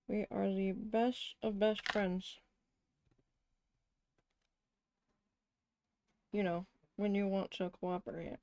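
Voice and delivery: talking around a paintbrush; words muffled by a brush in teeth; paintbrush in mouth. Real, not acted. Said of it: "I have a paintbrush in my mouth".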